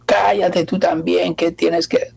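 high, slow mock snap at a partner; hammed-up angry driver telling his wife to shut up; slow, high mock outburst; angry retort.

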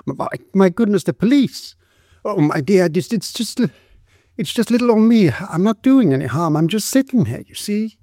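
high, stammering woman's voice; flustered, frightened. A narrator's reading. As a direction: plead harmlessness to the police, high and anxious. Frightened woman placates the police.

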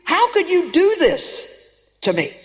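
a grieving heart's reproach; anguished pleading. Retold or imitated. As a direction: cry out to someone lost, slowly. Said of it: "you're kinda like saying".

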